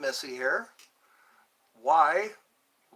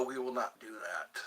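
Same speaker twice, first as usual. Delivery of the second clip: a hushed secret refusal; whisper; 6 semitones lower, 9 dB quieter than usual. Real, not acted. Streamer whispering a refusal to chat, whisper. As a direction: whisper it, unvoiced.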